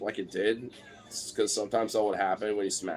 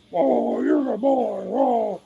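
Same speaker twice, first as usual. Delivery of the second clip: put-on high, loud baby voice; gushing pet talk; 13 semitones higher, 7 dB louder, slower than usual. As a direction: coo loudly and high over the dog. Praising dog he lifts up.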